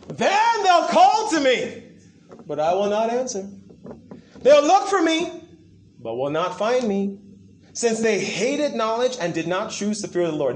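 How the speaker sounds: preacher thundering God's warning at the congregation; preaching scripture. Acted. Preaching scripture at full force.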